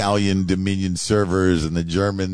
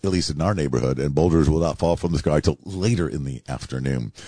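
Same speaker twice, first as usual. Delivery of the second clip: ordinary delivery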